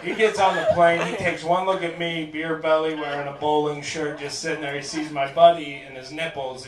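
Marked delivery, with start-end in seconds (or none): none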